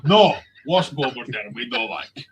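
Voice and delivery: lightly voiced Viking accent; hint of a Scandinavian Viking. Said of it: "my one hundred percent accurate Viking impression".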